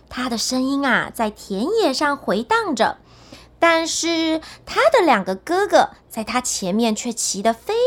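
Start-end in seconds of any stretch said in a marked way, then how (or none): none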